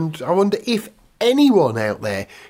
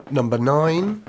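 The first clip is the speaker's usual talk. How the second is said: bingo caller; 2 semitones lower, slower than usual. Pretend. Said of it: "You could do F one bingo".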